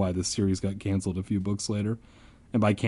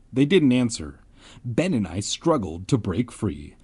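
ordinary delivery

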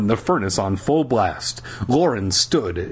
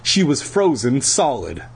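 usual speaking voice

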